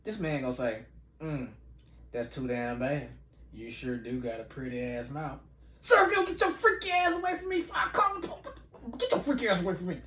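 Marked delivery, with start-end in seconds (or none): 1.2-5.4 low, soft and creepy; creepy stranger. Acted. The stranger pressing him, low and creepy. 5.8-10.0 high, outraged and scolding; outraged high shriek. Acted. Ordering the stranger off, high and outraged.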